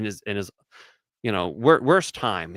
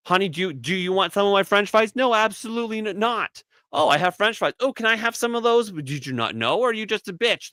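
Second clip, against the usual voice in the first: mock couple quarrel; 9 semitones higher than usual. Acts out a french fries quarrel.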